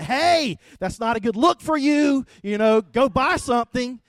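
loud, high mock yell across the yard; neighbor yelling. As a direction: shout it like a nosy neighbor calling over. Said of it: "My neighbor yelled at me".

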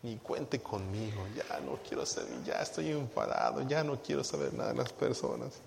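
soft, low-energy grumble of a tired leader; weary quitting leader. Retold or imitated. To the pastor, a burnt-out leader quietly refusing. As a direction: mutter it like you've given up serving.

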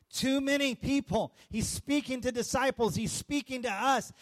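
ordinary talk

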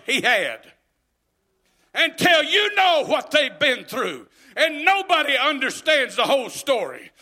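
loud, rising preacher's cry; stirring church preaching, building loud; full-force preaching. Acted. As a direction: preach it out to the whole congregation.

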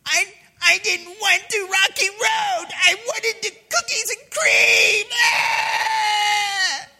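very high, shouted tantrum whine; wailing bratty kid. Acted. Child to parents over ice cream, high wailing tantrum. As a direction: wail like a spoiled child, stretching the end. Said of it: "this just terrible attitude".

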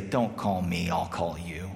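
a hint of a weary God; God brushing off callers, low and soft; God in a cartoon. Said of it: "showed God saying".

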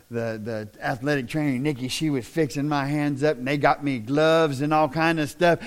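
usual speaking voice

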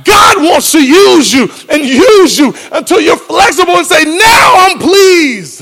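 high, loud preaching build; preacher shouts that God recycles people; full-force preaching. Acted. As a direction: preach it up loudly.

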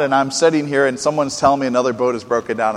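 normal talking voice

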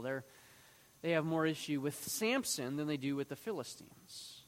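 normal talking voice

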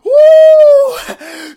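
high, loud sustained "woo"; whooping mid-scripture; excited whoop. Real, not acted. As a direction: let out a long, high preacher's whoop. Preacher whoops mid-verse.

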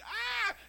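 mock high-pitched yelp of fright; retold scream. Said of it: "he screamed".

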